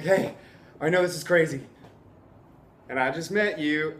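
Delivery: acting winded after a run; out of breath. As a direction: imitate panting out a greeting. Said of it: "I'm, like, out of breath".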